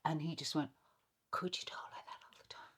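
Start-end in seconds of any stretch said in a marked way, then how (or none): 1.3-2.7 breathless whisper of awe; whispered question. Retold or imitated. London friend reacting to the drama-school voice, in a whisper. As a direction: whisper it, half-scandalised.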